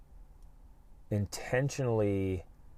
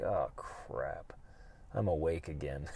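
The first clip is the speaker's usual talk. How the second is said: low, much softer groan of a reluctant waker; groggy dread; 6 semitones lower, 11 dB quieter than usual. Retold or imitated. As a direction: drag it out like you don't want to get up. Dreading the alarm clock.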